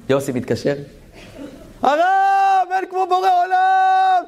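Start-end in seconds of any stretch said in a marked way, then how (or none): 1.8-4.2 high, loud joyful yell down the phone; ecstatic shout. Retold or imitated. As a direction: shout it out in pure rapture. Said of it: "צועק". (צעקה נלהבת בטלפון)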